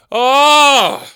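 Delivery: high wounded shout; anguished wail. Acted. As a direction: cry out in comic outrage. Old Dick Lips outburst.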